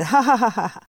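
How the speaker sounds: loud, acted ha-ha laugh; conductor's jeering laughter; mocking laugh. A narrator's reading. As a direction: guffaw at her expense. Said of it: "Biletçi gülmeye koyulmuştu".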